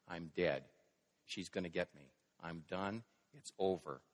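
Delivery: retold despair, low and subdued; defeated. Preacher voicing Elijah giving up in fear, mildly dejected. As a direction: voice a mild, quiet resignation. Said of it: "overwhelmed with fear and oppression".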